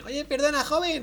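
put-on high old lady voice; man doing a woman's voice. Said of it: "intentando hacer voz de señora".

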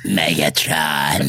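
low, drawn-out raspy villain; deep, raspy robot villain; Megatron. Acted. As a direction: growl it slowly from the back of the throat. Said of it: "very raspy".

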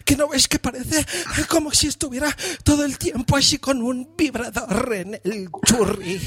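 high breathy moaning woman's voice; mock a moaning Spanish movie heroine; mock breathless film actress. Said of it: "hablan así como si se las estuvieran follando".

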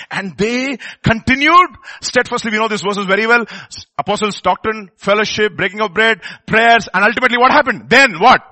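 loud, high, urgent sermon delivery; impassioned pulpit exhortation; forceful preaching. Real, not acted. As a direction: preach it out at full voice.

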